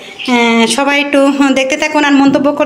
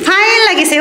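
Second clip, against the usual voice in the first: put-on echoing the child; 6 semitones higher than usual. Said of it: "আমার কাছে তো fine লাগিছে".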